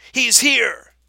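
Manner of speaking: loud, high-pitched cry of arrival; high excited yell; joyful shout. A narrator's reading. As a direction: shout it out to announce the news. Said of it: "they shouted".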